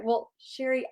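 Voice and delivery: ordinary talk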